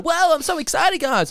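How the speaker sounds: put-on high, over-excited kids' presenter; mock a gushing children's entertainer; squeaky-high hammed-up excitement; Blippy. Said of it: "he talks like this the whole time".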